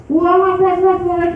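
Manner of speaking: very high-pitched repeated whoops; imitated monkey chatter. Preacher mimicking a monkey in a tree, chattering.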